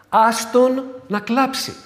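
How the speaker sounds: a mild rebuke to grieving kin; scolding relatives. Retold or imitated. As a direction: insist firmly, let him cry. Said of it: "να μαλώσω λίγο κάποιους συγγενείς".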